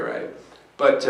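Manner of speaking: ordinary delivery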